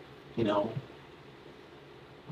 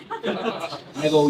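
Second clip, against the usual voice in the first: ordinary talk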